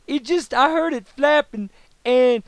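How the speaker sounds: high, loud and slow put-on drawl; telling a coworker he couldn't stop the jam, in a high silly voice; John Travolta impression. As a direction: ham up a cartoonish Simpsons-style Travolta. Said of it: "that's just my John Travolta".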